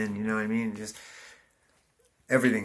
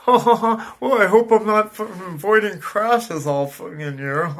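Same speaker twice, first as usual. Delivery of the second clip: much higher and louder, giddy self-mockery; mock cheerful; 9 semitones higher, 9 dB louder than usual. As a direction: ham up the smug winner's glee.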